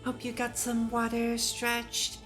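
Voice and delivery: ordinary delivery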